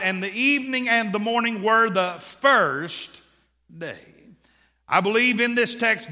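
loud, building preacher's cadence; preaching. Acted. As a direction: preach it to the back row. Preaching builds to full force.

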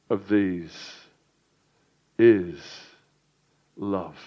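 slow, hushed, low reading with long pauses; solemn scripture reading. Acted. Preacher reads the key verse to the congregation, solemn and slow. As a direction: let each phrase settle, dropping low and soft.

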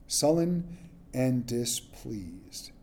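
slow, soft, sulky reading of "Sullen and displeased"; sullen and displeased, said slowly and softly. Shown as a demonstration. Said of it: "it means that he was peevish".

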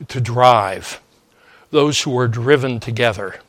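usual speaking voice

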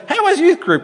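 preacher voicing a grandma greeting her grandchild, high granny voice. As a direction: put on a twittering granny. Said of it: "that's my grandma voice".

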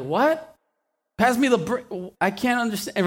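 a builder who can't understand his coworker, baffled; confused worker. Retold or imitated. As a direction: sound lost and confused.

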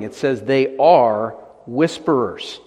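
slow, with "are" leaned on hard; stress on ARE. Shown as a demonstration. Preacher to congregation, sinners not sins: slow, "are" stressed. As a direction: press the word "are" to name who they are. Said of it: "meant to emphasize".

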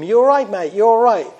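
a hammed-up false concern; imitating a fake-friendly boy. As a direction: play the thief pretending to care. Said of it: "the boy who's saying to him".

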